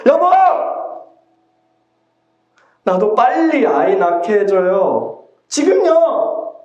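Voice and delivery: wife's outburst at her husband, high and slow at the end; exploding anger. Acted. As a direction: fling the demand at him, stretching the last word. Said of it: "라헬의 분노가 폭발합니다".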